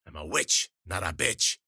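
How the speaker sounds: put-on snarled old-crone retort; menacing witch's snarl. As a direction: snarl it, punching the rhyming words. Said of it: "she snarled".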